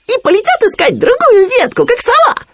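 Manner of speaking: very high, loud excited piping; Khryunya catching on. A narrator's reading. Khryunya working out Winnie's plan, high excited squeak. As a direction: blurt the guess in a high squeak. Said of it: "догадался Хрюня".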